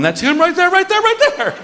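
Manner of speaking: very high squealing fanboy; crazy fan. Acted. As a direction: squeal it like an overexcited fan on tiptoes. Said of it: "the crazy fan on his tiptoes going".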